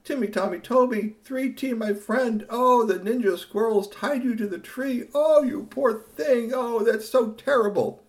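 high, loud, hammed-up pity; kind friend's sympathy. Narrator voicing Curtis comforting his friend, high and gushing. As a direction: gush over the friend like a kind pal. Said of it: "he should have said".

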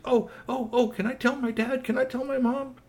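put-on squeaky little kid pleading; excited kids.

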